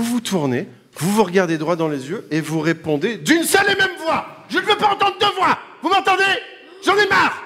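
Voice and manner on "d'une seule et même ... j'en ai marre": crié plus aigu, faussement excédé; exaspération surjouée. Acted. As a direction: jouer le maître du quiz à bout. Host plays a strict quizmaster. (exaspération surjouée)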